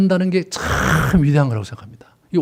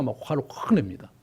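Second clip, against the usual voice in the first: normal talking voice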